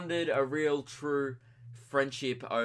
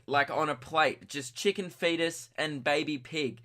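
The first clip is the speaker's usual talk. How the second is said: ordinary talk, nothing marked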